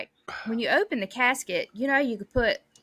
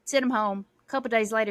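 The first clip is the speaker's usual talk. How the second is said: usual speaking voice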